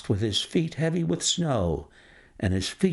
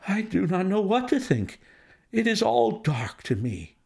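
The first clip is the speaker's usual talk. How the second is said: distressed banker; 8 semitones higher than usual. Acted. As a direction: recoil from the idea, aghast and pleading. Banker voiced by reader.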